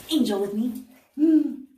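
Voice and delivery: excited. Real, not acted. Brings her little angel on camera.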